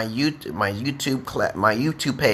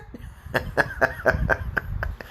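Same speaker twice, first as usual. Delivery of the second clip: breathy, voiceless chuckle; wheezy giggle at his own joke; laughing; 6 dB quieter than usual. Real, not acted. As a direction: run of soft laughter.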